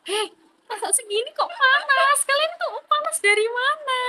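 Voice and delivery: high, slow incredulous scoffing; drawn-out, sky-high mocking wonder; mock disbelief. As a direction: scoff at them as if the heat were nothing. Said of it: "Tapi aku mikir di sini".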